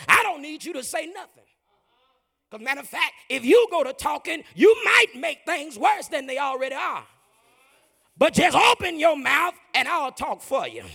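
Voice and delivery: loud high fervent preaching as God; booming sermon climax; preaching at full force. Acted. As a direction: drive it home hard.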